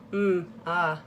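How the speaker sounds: soft bored "ah, oh"; lightly mimicked dismissive grunts. Therapist reacting to her assault, soft bored grunt. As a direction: mimic a therapist barely responding. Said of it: "it just".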